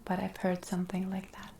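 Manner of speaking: ordinary delivery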